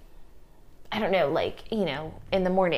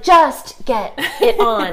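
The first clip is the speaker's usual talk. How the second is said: loud, high, very drawn-out order with a laugh; retold mom at her wits' end; exasperated yelling parent; 6 semitones higher, 9 dB louder, much slower than usual. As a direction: stretch every word in comic exasperation. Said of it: "I'm such a yeller".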